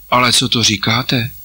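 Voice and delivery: put-on a light touch of glad astonishment in a boy's voice; boy hearing good news, mildly amazed; joyful amazement. A narrator's reading. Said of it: "svolal Pavlík v radostném úžasu".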